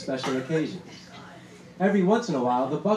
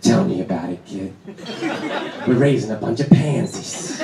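put-on much lower old-man voice; gruff grandfather; 7 semitones lower, 4 dB louder than usual. Grandfather in tracksuit scolding the narrator, much lower old-man voice. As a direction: drop low like a crusty grandpa. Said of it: "grandfather agreeing".

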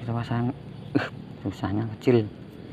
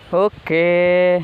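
excited; 6 semitones higher, 3 dB louder, much slower than usual. Real, not acted. First fish strikes on line.

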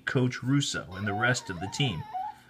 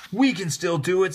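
higher, louder encouragement; rallying; 6 semitones higher, 5 dB louder than usual. Acted. Rebecca rallying her downcast teammates. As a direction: lift them up.

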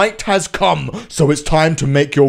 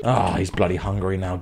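ordinary talk, nothing marked

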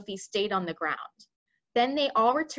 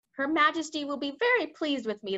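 a higher, puffed-up general's voice; pompous army head; 5 semitones higher than usual. Acted. Head of the army boasting to the BFG, higher and pompous. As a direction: play the smug head of the army.

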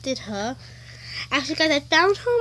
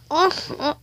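ordinary talk, nothing marked

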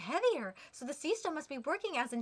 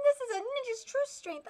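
higher, proud ninja boast; smug ninja; 9 semitones higher than usual. Acted. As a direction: brag like a sly ninja. Ninja boasts of his trick.

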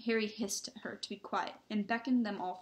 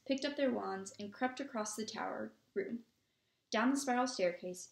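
ordinary delivery

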